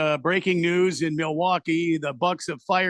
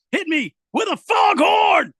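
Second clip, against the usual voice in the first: big high shout, slowed; foghorn shout; 13 semitones higher, 6 dB louder, slower than usual. Acted. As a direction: bellow it like a hype man. Shouted request ending fan message.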